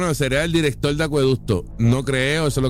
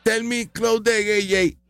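higher, slow whiny tattling; mock complaint; 8 semitones higher, slower than usual. Host mocking the politician complaining to Jay, slow whiny. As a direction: tattle like a wounded child. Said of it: "But I'm gonna tell you to Jay Fonseca".

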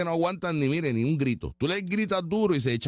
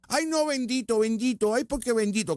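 high whining mock voice; mock their whimpering complaint; whiny protester imitation; 6 semitones higher than usual. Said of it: "no podemos seguir". (imitación quejumbrosa y burlona)

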